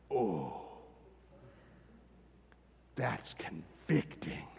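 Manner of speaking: soft, slow, struck quiet; hushed realization. Retold or imitated. Pastor recalling the video, quiet and struck. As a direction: let it land, quietly convicted. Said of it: "I was like".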